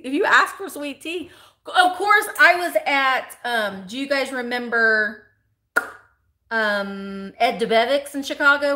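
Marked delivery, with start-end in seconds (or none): none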